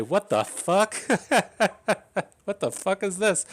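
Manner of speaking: high, loud, incredulous outburst breaking into a laugh; stunned disbelief. Retold or imitated. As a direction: blurt it out in shocked disbelief. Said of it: "you say".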